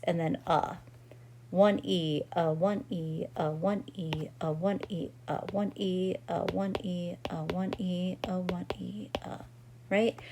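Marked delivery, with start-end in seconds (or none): 1.5-9.4 spoken counts dropping from normal to a whisper; counting fading to whisper. Shown as a demonstration. Teacher demonstrates counting aloud, fading to whisper. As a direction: chant the syllables, fading away each time. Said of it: "that is what we would speak out loud".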